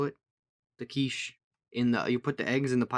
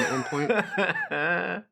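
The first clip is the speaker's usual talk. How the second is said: slowing into laughter, higher; laughing; 6 semitones higher, much slower than usual. Real, not acted. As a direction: crack up as the sentence ends. Cracks up at own story.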